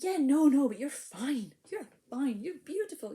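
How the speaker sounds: loud, hurried "no, no" that turns reassuring; mock reassurance. Friend reassuring someone who calls herself fat, mock comforting. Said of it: "you're like".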